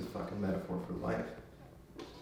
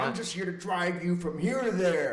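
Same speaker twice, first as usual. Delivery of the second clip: higher-pitched hammed-up insistence; mock brush-off; 6 semitones higher, 3 dB louder than usual. Reluctant driver to the kid, mock brush-off. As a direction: lay down terms as a mock tough hero. Cohost joins in as reluctant hero.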